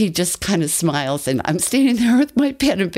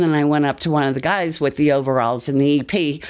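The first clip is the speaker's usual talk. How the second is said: ordinary talk, nothing marked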